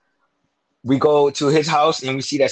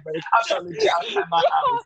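laughing; 3 dB quieter than usual. Real, not acted. Laughing through claim.

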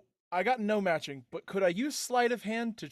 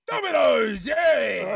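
loud, high mock-excited shout; excited cheer; 5 semitones higher, 7 dB louder, much slower than usual. Player's character greets a box of dominoes, excited shout.

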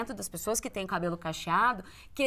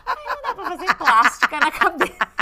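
laughing; 11 semitones higher, 9 dB louder than usual. Real, not acted. Laughing at own nickname.

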